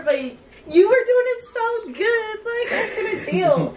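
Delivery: laughing and near tears; breaking up with laughter; helpless giggles. Real, not acted. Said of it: "I wish I could have done better".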